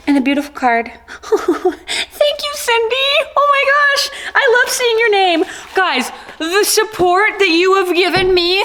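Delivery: high, teary thanks through laughter; tearful joy. Real, not acted. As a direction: choke up reading the card. Moved by fan's card.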